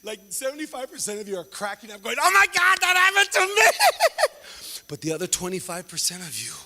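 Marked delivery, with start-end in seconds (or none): 2.2-3.7 high loud exclamation; excited recognition. Retold or imitated. Audience member laughing in recognition, high and loud. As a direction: blurt it out, high and thrilled.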